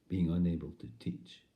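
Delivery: ordinary talk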